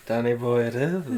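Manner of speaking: low, soft mock John line; a hint of [name]'s voice; John's way of saying it. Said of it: "The way he said".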